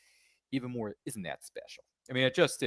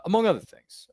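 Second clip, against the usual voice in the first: loud emphatic; 11 semitones higher, 12 dB louder than usual. Real, not acted. Host stresses a pun, very loud.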